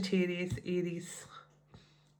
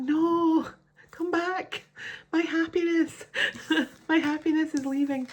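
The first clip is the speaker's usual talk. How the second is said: much higher, louder mock wail of a man begging; pleading masculine; 9 semitones higher, 4 dB louder than usual. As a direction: whine it like he's begging her back.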